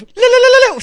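loud, high-pitched "la-la-la" ululation; high ululating trill. Shown as a demonstration. Imitates ululation over music.

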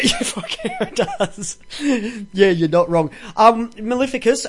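normal talking voice

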